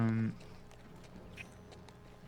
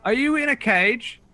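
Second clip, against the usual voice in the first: high, louder wizard voice; wizard asking; 13 semitones higher, 6 dB louder than usual. Acted. Griselda checks whether Boulder is caged, high. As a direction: ask it sharply from afar. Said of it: "it comes back".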